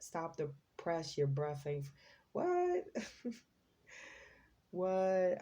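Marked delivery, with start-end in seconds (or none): 2.3-5.4 incredulous surprise. Real, not acted. Reader baffled by the line she just repeated, incredulous. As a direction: squeak it out in disbelief.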